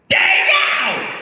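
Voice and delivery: very high, drawn-out screech; imitated high-pitched yell; excited woman stranger. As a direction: screech the name out, mimicking her. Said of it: "and she goes".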